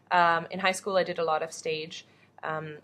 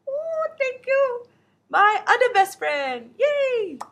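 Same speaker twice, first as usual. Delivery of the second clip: very high, drawn-out squeal of delight; mock gushing; 7 dB louder, much slower than usual. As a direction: squeal your thanks in a tiny high voice. Gushing thanks for a compliment.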